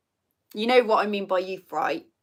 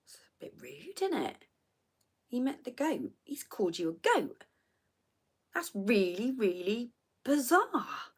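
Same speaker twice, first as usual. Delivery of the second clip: whispered, much softer mock disbelief; hushed aside; 10 dB quieter than usual. Retelling a hushed chat with Tim about the post, whispered. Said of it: "me and Tim looked at it and we were like".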